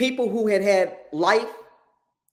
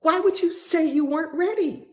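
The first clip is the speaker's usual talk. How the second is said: confronting; 9 semitones higher than usual. Retold or imitated. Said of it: "I just confronted him".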